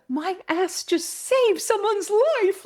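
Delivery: very high, drawn-out melodrama; slow, high-pitched hammed-up awe; mock dramatic. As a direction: overplay the thought like a grand revelation. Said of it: "it's a bit dramatic".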